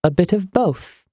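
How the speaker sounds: high, clucking comeback; clucked retort. A narrator's reading. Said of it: "Iana clucked".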